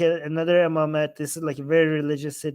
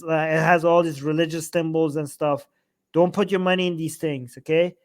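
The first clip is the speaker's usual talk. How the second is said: ordinary delivery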